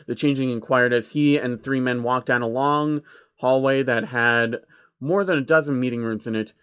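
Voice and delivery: ordinary delivery, nothing marked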